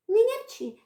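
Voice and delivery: high, strict motherly rebuke; stern mother. Acted. Girl sternly tells the cat not to meow. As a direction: tell off the cat firmly. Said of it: "строго відповіла на те Марійка".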